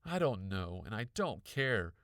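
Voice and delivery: loud scream, building to the end; angry kid screaming back at her brother. A narrator's reading. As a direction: scream it back over your shoulder. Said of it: "I screamed".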